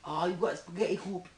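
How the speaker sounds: Chinese accent. Shown as a demonstration. Accent game with friends, mock Chinese accent. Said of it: "do Chinese again?".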